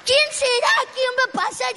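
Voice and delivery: loud, high, urgent asking; calling out questions. Acted. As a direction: shout it over a passing crowd. Blind man calls out to crowd. (preguntando a gritos)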